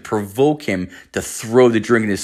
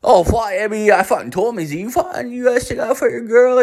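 put-on higher, fast, mumbled Newfoundland accent; thick Newfoundland accent; 13 semitones higher than usual. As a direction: rattle it off in a thick Newfoundland accent, swearing every word. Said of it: "I'm not gonna fucking translate that".

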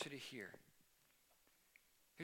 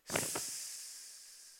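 hissing fizzle. Shown as a demonstration. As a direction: hiss out the sound of the fire going out. Arrow fire hissing out.

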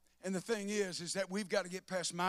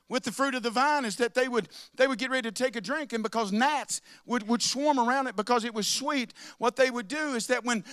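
rising preached explanation; 4 semitones higher, 7 dB louder than usual. Real, not acted. Louder preaching.

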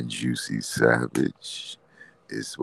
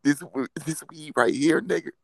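Cat Williams impression; 14 semitones higher than usual. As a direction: hype it up like a flashy comic. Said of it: "you gotta do the Cat Williams now".

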